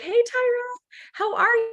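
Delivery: high, sing-song hello to a newcomer; bright friendly greeting. Shown as a demonstration. Mentor greeting new prospect Tyra on call, high cheerful hello. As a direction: pitch it way up, chirpy and welcoming. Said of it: "she's gonna be like".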